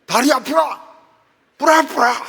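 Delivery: loud, high barked Gyeongsang retort; blunt Gyeongsang dialect. Retold or imitated. Gyeongsang husband answering his tired wife, loud blunt dialect. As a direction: snap it like a gruff Gyeongsang husband. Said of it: "이런데".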